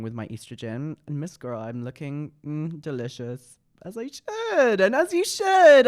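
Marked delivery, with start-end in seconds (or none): 3.8-5.9 much higher, louder, slow diva drawl; sassy catchphrase. Acted.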